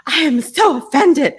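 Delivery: high, hammed-up offense; mock offended. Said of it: "I would be like".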